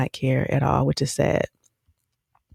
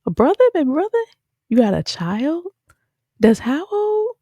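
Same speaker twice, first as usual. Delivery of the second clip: escalating disbelief; 11 semitones higher than usual. Retold or imitated. Viewer imagining her own reaction to the baby news. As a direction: pile on the stunned questions. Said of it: "I would have been like".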